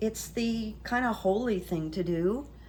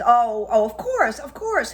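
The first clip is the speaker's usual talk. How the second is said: higher, louder, gushing reassurance; mock eager Stigler; 5 semitones higher, 8 dB louder than usual. Publisher gushing to Chance, eager higher voice. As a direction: fawn over him, brightly.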